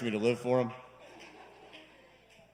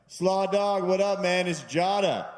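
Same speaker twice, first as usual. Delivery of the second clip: higher and louder, hyped bro greeting; 8 semitones higher, 6 dB louder, slower than usual. Retold or imitated. Calling childhood friend on the phone, loud eager bro greeting. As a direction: greet an old buddy all pumped up. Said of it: "you're like".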